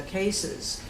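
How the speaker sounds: normal talking voice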